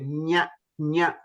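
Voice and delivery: "nya" from the palate; palatal nasal. Shown as a demonstration. Teacher moving to next sound, palatal "nya". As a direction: press tongue to palate on "nya". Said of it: "you have to from the Talavya".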